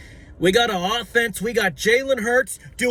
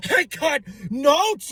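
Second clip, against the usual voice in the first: shouted proclamation; 8 semitones higher, slower than usual. Acted. Fan yelling at doubters that he has notes. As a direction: bellow it like a battle cry.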